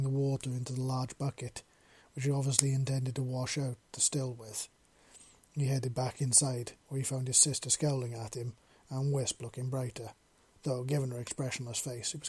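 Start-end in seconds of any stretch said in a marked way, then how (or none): none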